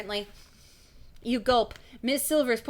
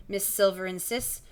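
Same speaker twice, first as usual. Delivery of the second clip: ordinary delivery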